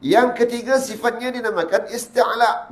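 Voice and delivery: ordinary talk, nothing marked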